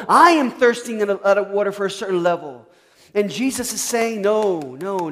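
loud, high-pitched sermon climax; impassioned preaching. Real, not acted. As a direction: drive each point home to the congregation at full volume. Preaches at full force.

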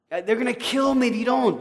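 panic, as if his life depends on a dollar; desperate plea. Retold or imitated. Man begs, claims danger.

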